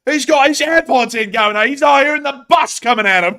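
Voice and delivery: high, loud hammed-up London accent; mock Cockney. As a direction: joke about a Londoner in a silly high Cockney voice. Mock Londoner narrating passerby.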